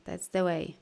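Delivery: ordinary talk, nothing marked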